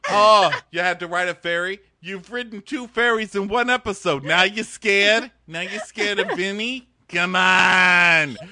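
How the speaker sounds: loud, high scolding; hammed-up scolding of a scaredy-cat; mock exasperated rant. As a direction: scold her like a fed-up friend. Mocking JoJo's fear, shouting.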